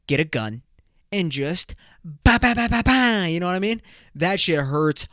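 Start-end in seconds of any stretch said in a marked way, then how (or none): 2.0-2.8 a louder, higher burst of mouth gunfire; mock gunshots. Joking about shooting after an insult, mock gunfire sounds. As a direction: spit out rapid pretend shots.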